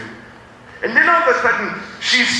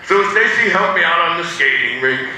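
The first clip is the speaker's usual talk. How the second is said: normal talking voice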